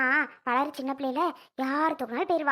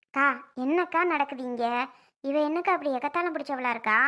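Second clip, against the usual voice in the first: usual speaking voice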